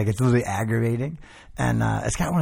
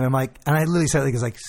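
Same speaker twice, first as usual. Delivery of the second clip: ordinary talk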